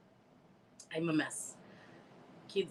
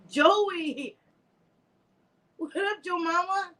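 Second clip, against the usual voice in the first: higher, bright hello; excited greeting; 10 semitones higher, slower than usual. Real, not acted. Greeting viewer J J, excited and high. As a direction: call out to a friend.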